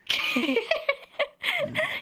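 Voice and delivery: high, long burst of laughter. Real, not acted. Teacher finishes a tongue twister demo, real high laugh.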